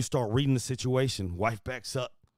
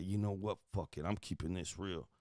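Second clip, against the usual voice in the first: quiet resolve; 6 semitones lower, 9 dB quieter than usual. Retold or imitated. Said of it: "And I say".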